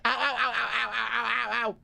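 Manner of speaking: giggling through each ow; laughing ow. Real, not acted. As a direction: laugh out the ows.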